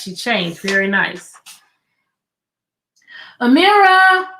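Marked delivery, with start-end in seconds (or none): 3.4-4.3 called out, high and loud; a dad calling his child from below. A narrator's reading. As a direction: call her name up the stairs. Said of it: "her daddy called out".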